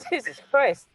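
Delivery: usual speaking voice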